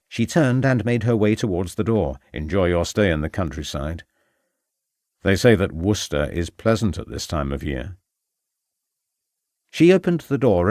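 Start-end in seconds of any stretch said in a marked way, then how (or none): 2.3-8.0 low male voice. Acted. Male character, subtle threat.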